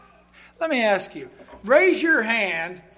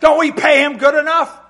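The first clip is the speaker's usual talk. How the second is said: mock indignation; 7 semitones higher, 10 dB louder than usual. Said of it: "They're gonna be saying".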